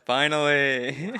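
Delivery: loud, slow, high exclamation; mock relief. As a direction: draw it out in hammed-up relief. Joins the joke about being healed.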